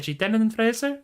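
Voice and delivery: ordinary talk, nothing marked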